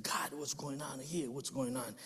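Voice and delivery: whispering in groggy confusion; confused whisper. Retold or imitated. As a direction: whisper it, still half asleep. Dazed man waking in car.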